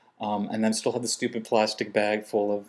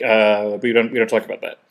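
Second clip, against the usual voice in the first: evasive instructor; 7 dB louder than usual. Retold or imitated. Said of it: "the instructors are like".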